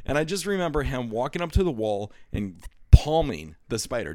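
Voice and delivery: ordinary delivery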